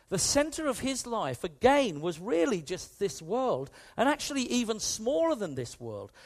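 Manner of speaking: ordinary talk, nothing marked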